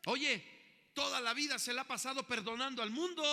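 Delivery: loud, high, fervent preaching; impassioned pulpit call, ringing out; preaching at full force. Acted. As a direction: drive each line home to the congregation. Preaching to congregation.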